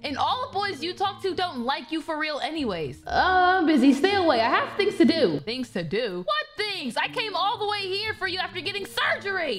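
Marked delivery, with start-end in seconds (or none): 3.6-5.4 put-on loud, haughty mean-girl voice; snobby mean friend. Snobby friend brushing off her old friend, loud and haughty. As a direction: look down on her, loudly.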